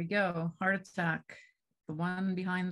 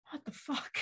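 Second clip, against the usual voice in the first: soft, higher mock disbelief; stunned realization; 9 semitones higher, 7 dB quieter than usual. Reliving a starstruck moment at dinner, hushed disbelief. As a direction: quietly voice a private moment of shock. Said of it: "just sit there and go".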